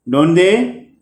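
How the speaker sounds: "don't they" with pitch climbing to the end; rising intonation. Shown as a demonstration. Teacher showing an echo question, rising on "they". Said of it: "rising intonations".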